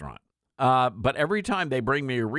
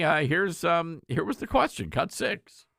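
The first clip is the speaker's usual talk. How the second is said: usual speaking voice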